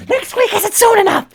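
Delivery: loud, high-pitched scolding; mock berating. Demon boss rejecting a deadline, loud mock outrage. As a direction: explode at the deadline, hammed-up.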